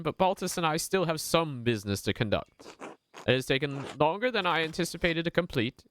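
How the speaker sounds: put-on gentle Italian accent, lifted pitch, unhurried. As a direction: speak as a polite Genoese trader in an Italian accent. Voices Naziu, Genoese merchant.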